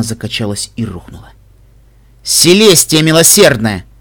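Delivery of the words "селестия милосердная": drawn-out, raised exclamation; exclaiming. A narrator's reading. Лайфблум cries out running up to Ксенит, exclaiming. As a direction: cry it out louder and higher, stretching the words. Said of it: "воскликнул Лайфблум".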